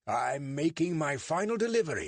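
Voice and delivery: David's put-on British accent, pitched high. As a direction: fake a polite British accent to fool a constable.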